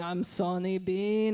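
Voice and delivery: slow, low spooky call; ominous drawn-out horror voice; Sawney Bean. Acted. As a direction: drawl it out like a cannibal in the dark. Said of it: "we can hear Sawney Bean in the darkness".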